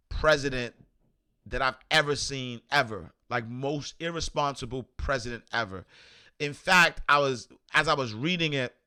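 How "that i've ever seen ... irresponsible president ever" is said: ordinary delivery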